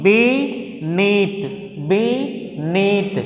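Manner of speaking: "beneath" split into two slow, separate syllables; teacher sounding out "beneath" in two pieces. Shown as a demonstration. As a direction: stretch both halves of "beneath", pausing between. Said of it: "two syllables".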